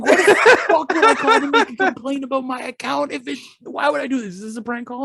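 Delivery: high-pitched mock rant, starting loud; exasperated outrage. Dad protesting to the phone agent, outraged. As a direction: imitate a dad at the end of his rope, half laughing.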